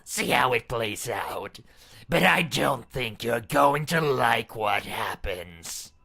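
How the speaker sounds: loud, mocking dare; a loud, sneering threat from the masked princess; menacing taunt. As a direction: goad him to try it.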